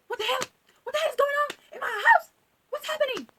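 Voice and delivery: high-pitched, mock-outraged mom; outraged mother. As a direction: imitate a mother shrieking at party crashers. Angry mother bursting in on party.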